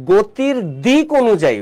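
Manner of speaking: slow, with দিক hit firmly; stress on direction. Shown as a demonstration. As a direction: lean hard on দিক to flag the key word. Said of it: "ওয়ার্ডটা শোনো".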